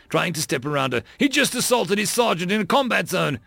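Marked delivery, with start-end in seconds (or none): 1.2-3.4 high-pitched, outraged objection; indignant protest. Acted. Lieutenant protests assault on a sergeant, indignant.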